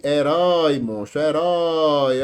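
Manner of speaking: "herói" repeated with a long, weighty last syllable; final-syllable stress. Shown as a demonstration. Teacher drilling the oxítona for students, "herói" stressed on the end. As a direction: lean on the "rói" each time in "herói". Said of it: "isso aqui é oxítona".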